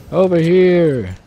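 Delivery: calling out. Real, not acted. Calling teammates over.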